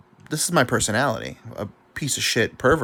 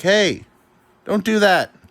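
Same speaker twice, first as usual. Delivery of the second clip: mock scolding; 9 semitones higher, 6 dB louder, slower than usual. Scolding the barking dude.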